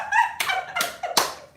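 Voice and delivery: very high, breathy giggle; high laugh. Real, not acted. Laughing at her own boast, squealing laugh.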